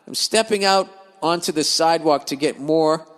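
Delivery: usual speaking voice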